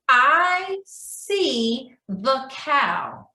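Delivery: slow, careful reading of a sentence. Shown as a demonstration. Teacher slowly reading a sight-word sentence to kids. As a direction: read each word slowly for little ones. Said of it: "that sentence will be".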